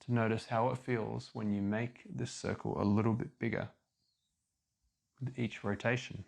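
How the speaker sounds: ordinary talk, nothing marked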